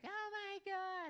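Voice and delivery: very high, drawn-out mock fangirl squeal; gushing girls. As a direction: imitate excited girls, shrieky and slow. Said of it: "every girl in the bar was like".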